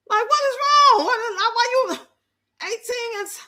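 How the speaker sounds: high-pitched, incredulous outburst; exasperated disbelief. Real, not acted. Streamer ranting at a stiff video, high exasperated disbelief. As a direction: pitch jumps up in real exasperation.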